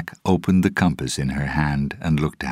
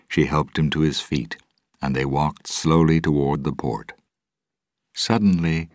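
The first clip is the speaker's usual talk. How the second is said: plain reading voice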